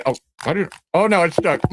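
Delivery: ordinary delivery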